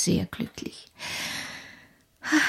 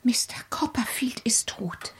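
leise geflüstert, fast tonlos; flüstere es verstört; whispered; 6 dB quieter than usual. Acted. Widow answers aunt, whispered. (erschrocken geflüstert)

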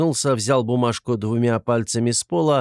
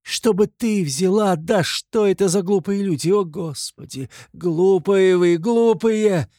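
high, indignant lament; crying out; 10 semitones higher, 5 dB louder than usual. A narrator's reading. Katerina scolding the foolish accusers, indignant cries. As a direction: wail louder and slower towards the end. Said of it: "кричала она".

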